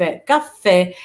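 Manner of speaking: "كافيه" with the f held long; doubled f. Shown as a demonstration. Teacher modelling both letters for learners, doubled f. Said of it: "لازم ننطقها مرتين".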